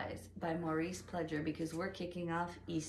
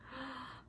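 excited gasp; 6 semitones higher, 11 dB quieter than usual. Acted. Searching for butterflies in story.

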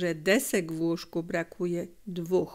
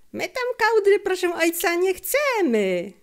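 put-on much higher, louder boyish cry, slowing at the end; boy shouting; 11 semitones higher, 5 dB louder than usual. A narrator's reading. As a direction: protest eagerly at full voice. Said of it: "krzyknął Felek". (głośny, wysoki okrzyk chłopca do ojca)

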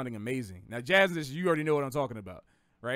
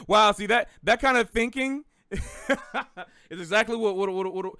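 laughing; 5 semitones higher, 6 dB louder than usual. Real, not acted. Reacting to a viewer's joke.

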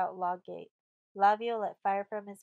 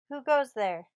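loud, higher sentry's challenge; cried challenge; 4 semitones higher, 8 dB louder than usual. A narrator's reading. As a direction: call out sharply into the night. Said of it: "he cried in his deepest tones".

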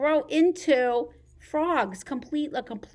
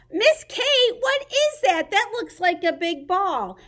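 put-on child's question; 7 semitones higher, 4 dB louder than usual. Librarian voices a child asking.

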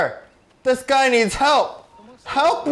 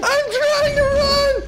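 panicked; slower than usual. Real, not acted. Being mauled by bear.

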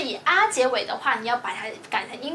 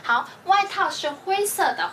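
ordinary talk

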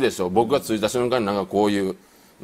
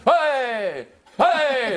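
mock whooping; 13 semitones higher, much slower than usual. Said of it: "って聞こえるんですよ".

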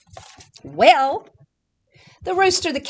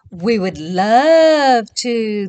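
slow, loud purring cat voice; 8 dB louder, much slower than usual. Acted. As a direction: purr it out like a contented cat. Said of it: "they purred".

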